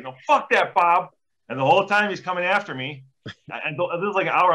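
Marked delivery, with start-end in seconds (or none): 0.0-1.1 a hint of an angry old Italian guy; mock the old guy's stubborn refusal; lightly voiced, higher indignant old man. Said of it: "He's like".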